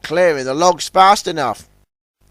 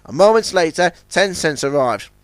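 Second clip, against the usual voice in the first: ordinary delivery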